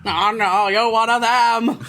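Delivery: mocking a movie villain's put-on American accent; Alan Rickman parody. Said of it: "make fun of Alan Rickman for Die Hard".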